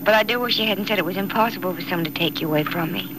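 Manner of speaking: ordinary talk, nothing marked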